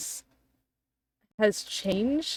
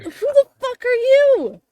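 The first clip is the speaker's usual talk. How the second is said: high-pitched, loud outrage; squawking, affronted challenge; indignant shout; 9 dB louder than usual. Acted. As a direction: demand it at the top of your voice. Hurt character challenges a stranger.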